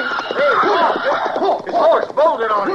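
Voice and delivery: high laughing yell over the chaos; laughing shout. Acted. Horse bolts, laughing shout.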